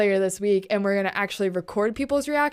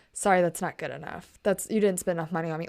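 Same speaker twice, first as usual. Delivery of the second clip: mock dismissive; 5 semitones lower, 5 dB quieter, faster than usual. Mimics streamers' response to a child.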